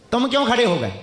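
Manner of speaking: ordinary talk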